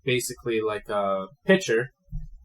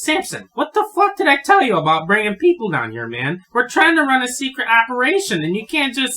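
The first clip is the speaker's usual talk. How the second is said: put-on high, loud scolding of a frail old man; angry old man; 13 semitones higher, 10 dB louder than usual. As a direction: chew him out like a furious old tinkerer. Franklin scolds Samson.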